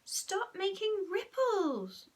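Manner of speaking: put-on high, slow, drawn-out child's voice; high-pitched whiny boy; wrong character voice. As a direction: plead slowly in a high little voice. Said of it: "Sorry, wrong voice again".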